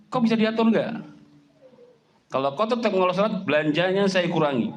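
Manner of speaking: high, loud husband warning; firm scolding. Acted. Husband threatening to cut his wife's allowance over prayer. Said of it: "mestinya suami".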